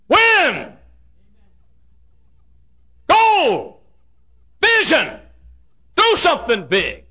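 high, loud one-word cries; shouted watchwords. Real, not acted. Preacher rallying the church, high shouted cries. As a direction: ring out each word like a rallying call.

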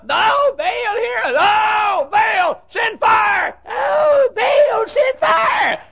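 very high shouted plea for fire; frantic shrieking prayer. Acted. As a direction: scream to Baal, rising in desperation. Prophets screaming for fire.

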